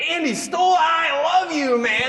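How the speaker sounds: high, loud, drawn-out joyful yell; excited shout. Retold or imitated. Billy running down the hall to hug Andy, high ecstatic shout. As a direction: bellow it with over-the-top joy. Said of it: "he goes".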